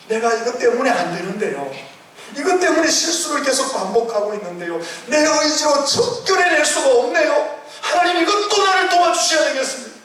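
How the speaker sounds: rising pulpit fervour; preaching at full force. Acted. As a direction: preach it up high and strong. Acted prayer within sermon.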